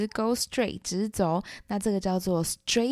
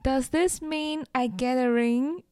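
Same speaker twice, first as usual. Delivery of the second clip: higher, slow, drawn-out cutesy question; cute acceptance; 8 semitones higher, slower than usual. Shown as a demonstration. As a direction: ask it sweetly, stretching the opening "ah". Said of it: "可以更可爱一点". (可爱地拖长音，撒娇发问)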